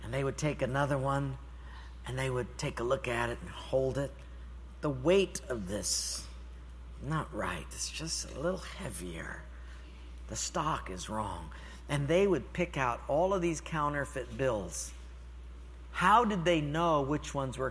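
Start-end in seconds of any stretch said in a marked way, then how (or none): 4.8-11.5 soft, slow, half-whispered judgment; hushed scrutiny. Retold or imitated. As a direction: murmur it while studying the bill. Inspector judging a bill quietly.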